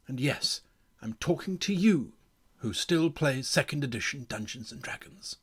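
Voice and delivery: measured, weighty announcement to the audience; mock-solemn address. Game designer warning old-edition players, mock-solemn address.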